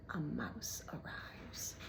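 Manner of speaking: soft, slow whisper; menacing whisper. A narrator's reading. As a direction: breathe it out with dark delight. Rat whispering.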